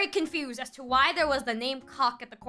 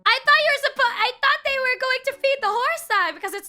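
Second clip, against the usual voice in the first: high and loud, stunned rant; loud disbelief; 6 semitones higher, 5 dB louder than usual. Real, not acted. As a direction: yell in outraged disbelief. Reacting to horse hack.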